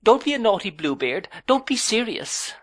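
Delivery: put-on pleading young wife. A narrator's reading. Young wife begs her husband not to be serious, high and wheedling. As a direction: wheedle him out of being serious.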